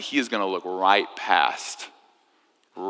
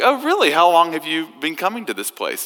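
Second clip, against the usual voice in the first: high, eager tourist voice, much higher pitch; chatty American tourist; 7 semitones higher than usual. Retold or imitated. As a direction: play the clueless tourist, bright and curious. Tourist questioning the Queen.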